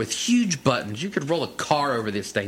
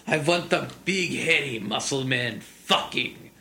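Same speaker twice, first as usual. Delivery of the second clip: put-on slow heavy German accent; slower than usual. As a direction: act out a German fan ordering. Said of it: "with a very thick German accent".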